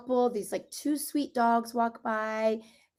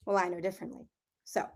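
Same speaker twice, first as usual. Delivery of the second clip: ordinary talk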